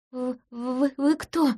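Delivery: stammering, slow and high with fear; frightened stutter. Acted. Woman caught under a false name stammers in fear. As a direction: catch on every first sound as if choking. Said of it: "Заикание лечится прекрасно".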